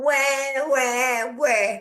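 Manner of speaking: mocking whiny wah wah; mock crying. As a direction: imitate a whiny baby crying. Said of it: "all we heard".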